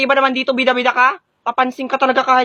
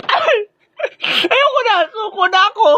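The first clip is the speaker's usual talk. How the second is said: panicked pleading; 7 semitones higher than usual. Acted. Surrendering in fight.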